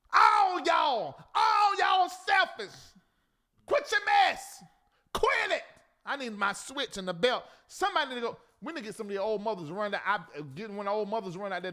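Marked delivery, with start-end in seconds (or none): 0.1-5.6 high, loud telling-off; hammed-up indignant outburst; exasperated scolding. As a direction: scold them like naughty children, drawn out and high. Scolding selfish churchgoers.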